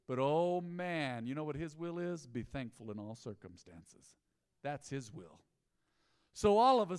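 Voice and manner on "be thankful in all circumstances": softer and lower, drawn in close; quietly earnest reading of the verse; hushed verse. Shown as a demonstration. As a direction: let the verse drop to a hush. Preacher quoting the verse.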